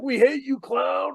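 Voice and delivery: mock heckle, pitched high; retold angry crowd. Crowd at boiling point heckling the clown, mock angry. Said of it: "it gets to a boiling point".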